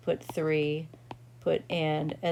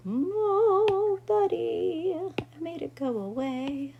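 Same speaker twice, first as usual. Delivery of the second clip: much higher, sing-song coo to a little one; cooing aside; 11 semitones higher, slower than usual. Real, not acted. Teacher breaks off to coo at someone nearby, much higher.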